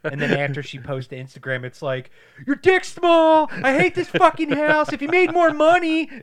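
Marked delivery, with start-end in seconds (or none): 2.5-6.1 very high, loud, slow shrieking rant; shrill nagging wife. Pretend. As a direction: screech like a bratty wife.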